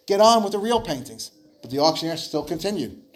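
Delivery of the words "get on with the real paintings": raised, higher-pitched angry protest; an impatient bidder demanding the masters. Acted. At the auction, a bidder angrily demands the famous paintings. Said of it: "Another voice angrily said".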